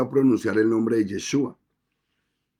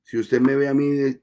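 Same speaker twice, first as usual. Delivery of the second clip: ordinary talk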